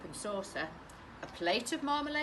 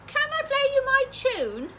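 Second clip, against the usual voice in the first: put-on very high, loud eager boy; boy asking mum, pitched way up; high child voice; 11 dB louder than usual. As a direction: ask like an excited little boy. Boy asks mum in bath.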